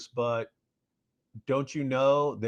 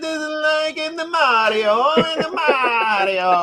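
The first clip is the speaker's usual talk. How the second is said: much higher, slow, hammed-up Italian accent; 7 dB louder, slower than usual. Mocking a YouTuber with a hammed-up Italian accent. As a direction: stretch it out like a cartoon Italian. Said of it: "This is the best impression of Cameron Bertuzzi".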